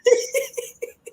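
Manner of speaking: high burst of laughter. Real, not acted. Host laughs at congestion remark.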